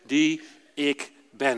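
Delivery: slow and calm, each word weighed. Retold or imitated. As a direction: read it slowly and calmly like a scripture reading. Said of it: "heel rustig gelezen". (heel rustig en langzaam)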